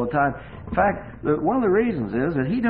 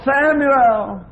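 second loud, high call, more urgent; voice of God calling; 11 semitones higher, 8 dB louder than usual. A narrator's reading. God calling Samuel a second time in the night. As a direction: call him again, ringing out. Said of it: "And the voice said".

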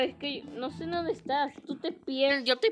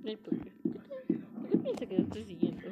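whispering; 2 semitones lower than usual. Real, not acted. Whispered aside.

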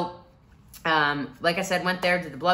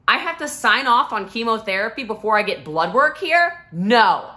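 higher, louder indignant question then no; outraged refusal; 5 semitones higher, 8 dB louder than usual. Retold or imitated. Patient refusing chemo before bloodwork, loud outrage. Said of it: "And I went".

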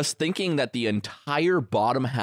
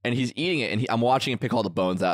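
ordinary talk

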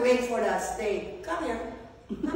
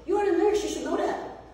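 mock hospital staff; 6 semitones higher than usual. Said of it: "they will tell you".

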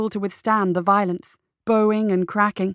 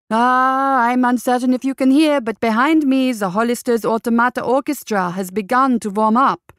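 louder and higher, raised over the band; speaking over music; 4 semitones higher, 5 dB louder than usual. Acted. Radio host raising his voice over the orchestra behind him, louder. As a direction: push the voice over the orchestra warming up.

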